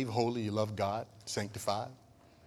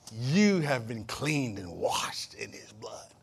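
whisper; much slower than usual. Real, not acted. Assuring saved congregation.

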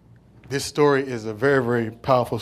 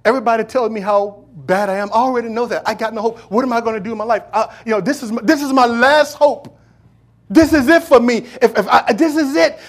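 building from pleading to a loud, high desperate cry; the broken woman's plea, rising to a high, loud wail; 8 semitones higher, 11 dB louder than usual. Retold or imitated. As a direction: cry out like it's your last hope. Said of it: "a woman that felt like".

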